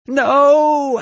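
loud, very high drawn-out NO; high-pitched, full-voiced cry of refusal; anguished cry. A narrator's reading. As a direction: scream it out in protest. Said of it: "Ashley cried".

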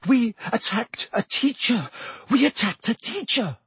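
high, frightened whimper. A narrator's reading. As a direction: gasp it in panic. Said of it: "Hermione whimpered".

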